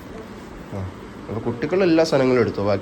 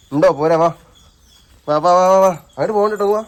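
high, raised-voice beckoning; 6 semitones higher, 5 dB louder than usual. Real, not acted. Calling a child to come back. (കുട്ടിയെ ഉറക്കെ വിളിക്കുന്നു)

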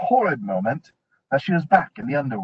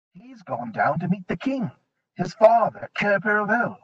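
lightly voiced fawn; 6 semitones higher than usual. A narrator's reading. Fawn answers.

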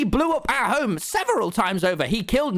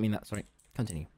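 meek quiet; 11 semitones lower, 12 dB quieter, faster than usual. Real, not acted. Meek after apology.

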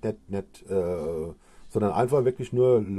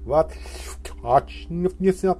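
backwards talk; 4 semitones higher than usual. Pretend. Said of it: "rückwärts zu reden".

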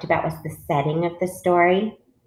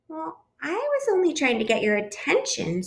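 high, softened little voice; little bird voice; 9 semitones higher than usual. A narrator's reading. Bluebird explains to scolding parents, little bird voice. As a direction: explain yourself in a small high voice. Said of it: "said the little bird".

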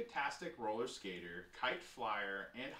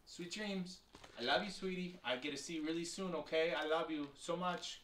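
higher-pitched, loving good night; affectionate; 6 semitones higher than usual. Real, not acted. Reader says good night to a sweetie, affectionate.

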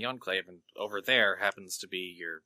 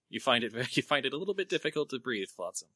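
ordinary delivery

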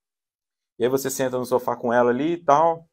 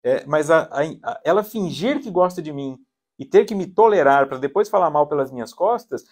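ordinary talk

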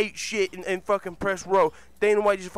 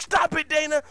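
high, loud order to stop; acted urgent shout; 11 semitones higher, 7 dB louder than usual. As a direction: shout like security rushing into a brawl. Acted security shouting to intervene.

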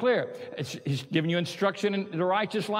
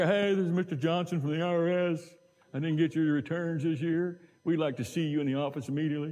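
lightly put-on official IRS caller; a hint of a stern tax official on the phone; prank caller voice. Pastor pranking a friend, mildly faking a stern IRS official. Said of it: "mess with her like I was somebody else".

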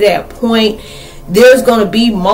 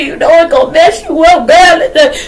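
high mock scolding, rising to a shout; scolding herself; 11 semitones higher, 4 dB louder than usual. Talking to herself about sad movies, high mock shout. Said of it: "I'm like".

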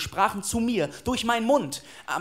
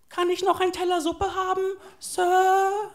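very high, small begging voice; pleading orphan boy; 10 semitones higher than usual. Acted. Orphan asks Sir for more soup, high pleading voice. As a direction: beg timidly, stretching the final Sir.

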